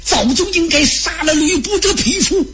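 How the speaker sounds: high-pitched, eager exclamation; acted outcry. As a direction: cry it out high and heated. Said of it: "刘备不让张飞喊了".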